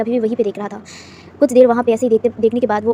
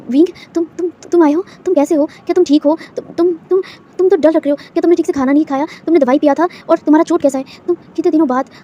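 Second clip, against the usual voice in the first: higher, anxious torrent of questions; fretting over him, words tumbling out; worried rush; 5 semitones higher than usual. Acted. Boss sees Wing return after days, anxious and higher. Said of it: "परेशान होकर देख रहा था".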